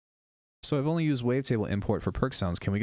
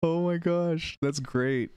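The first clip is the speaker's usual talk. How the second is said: high, delighted exclamation; amused delight; 8 semitones higher, much slower than usual. Real, not acted. Streamer delighted by the synth's accent, high amused exclamation. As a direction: exclaim high, tickled by what you just heard.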